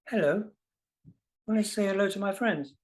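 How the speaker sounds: high, coaxing voice for the cat; pet talk. Real, not acted. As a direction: coo at the cat to make her greet the guests. Talking to his cat Luna.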